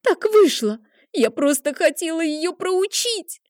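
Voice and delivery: sobbing. A narrator's reading. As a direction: cry out the excuses in tears. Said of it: "зарыдала она в голос".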